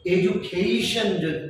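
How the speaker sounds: এডুকেশন stretched very slowly as ed-ju-kei-shon; এডুকেশন, each syllable held, ed-ju-kei-shon; slow demo. Shown as a demonstration. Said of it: "আমি যদি বলি এডুকেশন".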